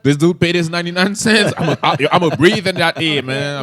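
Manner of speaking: loud, high mock-indignant caller; indignant creepy caller. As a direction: play a hammed-up prank caller insisting on his money's worth. Voicing a creepy heavy-breathing caller.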